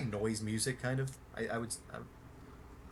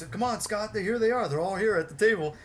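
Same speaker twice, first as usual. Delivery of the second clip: higher and louder, barking orders to fans; bossy Billy voice; 9 semitones higher, 5 dB louder than usual. Retold or imitated. Billy ordering fans onto the bus, loud high bossy voice.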